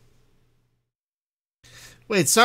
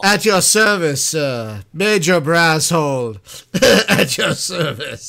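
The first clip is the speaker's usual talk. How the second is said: mock military salute; 3 semitones higher, 7 dB louder, slower than usual. Mock military greeting, hammed.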